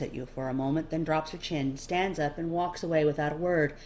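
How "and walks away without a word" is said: ordinary delivery, nothing marked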